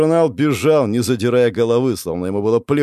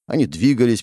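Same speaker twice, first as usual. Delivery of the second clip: plain reading voice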